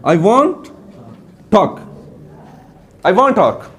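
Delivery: I want talk, to melted away; teacher repeats the right form for class; linked I want talk. Shown as a demonstration. I want talk जुड़ा हुआ. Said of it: "ये क्या बनेगा".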